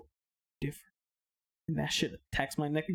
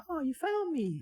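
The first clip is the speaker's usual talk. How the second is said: put-on high-pitched playful child; high little-girl storytelling voice; 11 semitones higher than usual. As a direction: lightly mimic a delighted little girl. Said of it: "this little girl".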